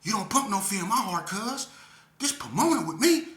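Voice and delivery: high, loud street taunt; tough woman's taunt. Retold or imitated. Skinny woman taunting the speaker in line, high and loud. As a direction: puff up and taunt him. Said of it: "She gonna look at me and say".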